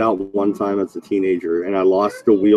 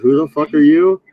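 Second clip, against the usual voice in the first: louder, higher, baffled demand; confused outburst; 5 semitones higher, 5 dB louder than usual. Retold or imitated. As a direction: blurt it out, totally lost. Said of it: "And I'm like".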